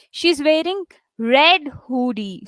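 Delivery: slow, with weight on "red" and "hoodie"; stress on RED, HOODIE. Shown as a demonstration. Teacher demonstrating content words, "red" and "hoodie" stressed. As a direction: set RED and HOODIE apart deliberately. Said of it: "here I'm stressing on red and hoodie".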